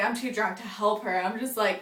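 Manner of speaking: ordinary talk, nothing marked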